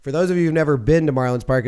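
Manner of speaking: normal talking voice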